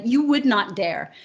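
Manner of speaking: ordinary talk, nothing marked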